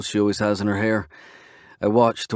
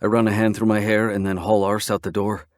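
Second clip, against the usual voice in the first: ordinary delivery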